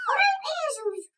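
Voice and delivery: قريب thrown back in annoyance; indignant retort. Acted. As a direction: snap the echoed word back at her.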